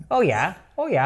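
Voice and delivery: mock New Zealand bedroom talk; Kiwi accent. Comic imagining a New Zealander during sex, mock Kiwi accent. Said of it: "the Kiwi accent too".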